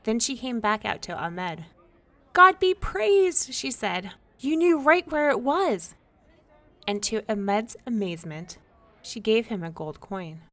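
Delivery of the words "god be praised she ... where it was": rejoice with loud relief; joyful thanks. A narrator's reading.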